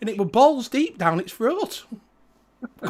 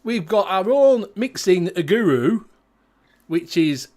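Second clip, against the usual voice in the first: broad, slowed Yorkshire accent played up for the show; 4 dB louder than usual. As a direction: talk like a Barnsley lad greeting the viewers. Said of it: "in proper Yorkshire".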